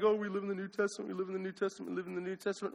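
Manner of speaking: soft, dismissive mock of complacent believers; mocking quote. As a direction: parrot the excuse, lightly sneering. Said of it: "Some people are like".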